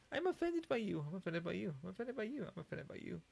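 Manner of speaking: sing-song fake outrage, dying away; mock offended whine. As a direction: parody a touchy complainer. Mocking easily offended people.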